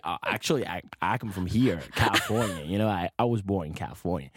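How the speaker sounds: put-on Californian American accent; bluffing in a fake American accent; best American accent. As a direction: pass yourself off as American. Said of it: "je prends mon meilleur accent".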